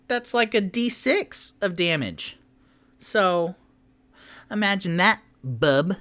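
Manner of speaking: high, loud, fast sassy ranting; cocky little rogue. Acted. Tipsy taunting the bandit over one hit point, loud and high. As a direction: needle him, all cheek and swagger.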